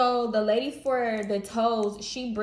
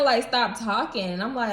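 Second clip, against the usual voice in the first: normal talking voice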